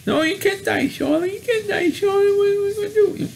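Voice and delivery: very high, tearful whining pig voice; whiny pig. Retold or imitated. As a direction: beg like a panicked little piglet, close to tears. Pig begs dying spider to stay.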